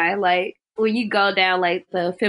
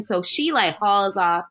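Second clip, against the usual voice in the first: usual speaking voice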